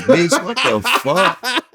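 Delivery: high, slow, laughing disbelief; mock outrage. Retelling tourists touching buildings, high laughing outrage. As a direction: play it up, laughing at the absurdity. Acting out his reaction to tourists.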